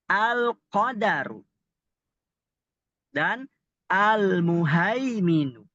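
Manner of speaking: slow, stretched-out reading; slow model reading; demonstrated example words. Shown as a demonstration. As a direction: draw out each syllable for learners. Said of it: "perhatikan contoh berikut".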